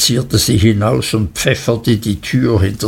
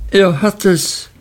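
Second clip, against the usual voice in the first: higher, crying out in alarm; alarmed exclamation, pitched up; 8 semitones higher than usual. A narrator's reading. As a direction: call out in horror. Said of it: "rief Miss Murdstone".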